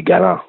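"galant" said the French way, final t silent; Acadian French pronunciation. Shown as a demonstration. Singer showing his surname in French, "galant" with silent t. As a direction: say "galant" as ga-LAHN with a nasal ending. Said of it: "if you said it in French".

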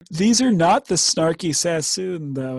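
ordinary delivery

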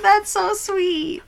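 high, slow aww; tender gush. Real, not acted. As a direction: melt at the story. Touched by story.

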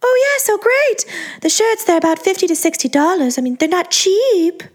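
high sing-song sales pitch; sing-song jubilant. A narrator's reading. Pricing shirts for the narrator, a high sing-song voice. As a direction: lilt it up high, all cheer. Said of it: "her voice took on a sing-song quality".

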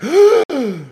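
high, loud gasp of delight; mock excited gasp. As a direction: gasp as if thrilled, hammed-up. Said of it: "They get even more excited".

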